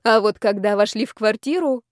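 plain reading voice

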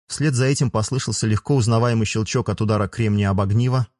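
ordinary delivery

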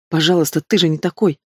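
plain reading voice

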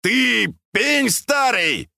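slow, high insult yelled out; drawn-out high bellow; straining shout. A narrator's reading. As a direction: strain to be heard across the water. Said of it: "Пельмень крикнул, надсаживаясь".

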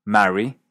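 "Marry" with an open a as in "cat"; distinct vowel. Shown as a demonstration. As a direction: keep "Marry" apart with a flat-out open a. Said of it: "se mantienen distintas".

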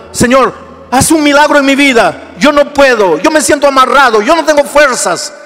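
high, loud desperate cry to God; pleading prayer. Acted. Sinner begging God for a miracle, crying out loud. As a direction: shout the prayer like someone at the end of their rope.

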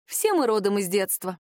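usual speaking voice

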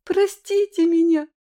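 put-on high, loud sobbing child's plea; crying girl. A narrator's reading. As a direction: beg through tears like a little girl. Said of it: "откровенно плачущим голосом изъяснялась она".

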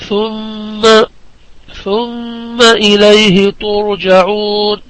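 slow recitation, holding the مّ in ثم nasally; tajweed ghunnah recitation. Shown as a demonstration. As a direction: hum long on the doubled م of ثم. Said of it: "أمثلة في الحروف".

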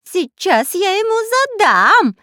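high, loud, drawn-out yell; threatening shout. A narrator's reading. Spy about to thrash the parrot, shouting. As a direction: yell it with swooping stretched words. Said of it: "закричал шпион Дырка".

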